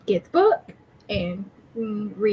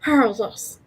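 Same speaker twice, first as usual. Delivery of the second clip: ordinary talk, nothing marked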